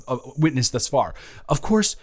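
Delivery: ordinary talk, nothing marked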